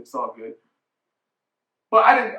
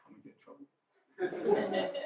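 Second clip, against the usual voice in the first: laughing whisper aside; 13 semitones higher, 11 dB quieter than usual. Real, not acted. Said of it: "aside, laughing whisper".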